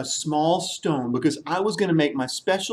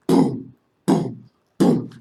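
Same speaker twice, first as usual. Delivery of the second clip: elephant footsteps; slower than usual. Acted.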